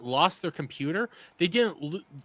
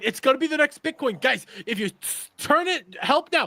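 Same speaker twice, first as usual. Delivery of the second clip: higher put-on Middle Eastern accent; 9 semitones higher than usual. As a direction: pitch it like a Middle Eastern crypto scammer. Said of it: "Ignore my Middle Eastern accent".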